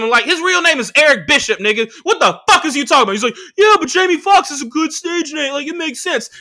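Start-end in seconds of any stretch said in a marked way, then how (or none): 0.2-3.1 high-pitched, exasperated comeback; incredulous retort. Retold or imitated. Retold argument, correcting the other comic. 3.6-6.3 high-pitched imitation of a defensive comic; mocked other comic. Voices other comic defending himself.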